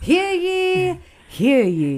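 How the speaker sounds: high-pitched royal crier call; herald announcing. Pretend. Sister echoes herald call, higher.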